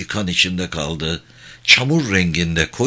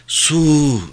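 higher, drawn-out moan for water; moaning plea; 6 semitones higher, 3 dB louder than usual. Acted. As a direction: moan it like a wounded man. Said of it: "diye inledi tekrar". (inleyerek su isteme)

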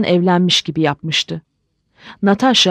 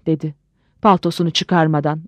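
ordinary delivery

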